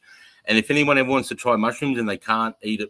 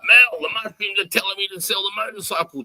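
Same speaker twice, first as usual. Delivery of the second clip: mock alarmed; 10 semitones higher, 4 dB louder than usual. Retelling telling wife about mushrooms.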